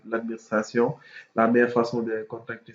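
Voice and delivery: ordinary talk, nothing marked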